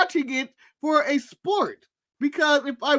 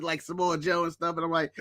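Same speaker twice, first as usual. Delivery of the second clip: ordinary talk, nothing marked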